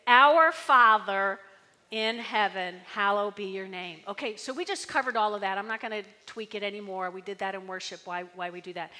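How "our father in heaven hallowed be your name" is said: leading reading. Shown as a demonstration.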